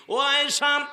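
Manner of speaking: high, loud preacher's cry of reproach; retold cry of alarm. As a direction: call out in dismay, imitating an alarmed husband. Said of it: "চিৎকার মেরে উঠলেন".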